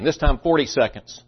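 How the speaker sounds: normal talking voice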